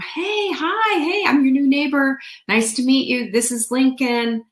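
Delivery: high, bright neighborly hello; high-pitched overfriendly greeting to neighbors; chirpy friendly greeting. Retold or imitated. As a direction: greet the neighbors like a cheerful newcomer. Greeting new neighbors, retold.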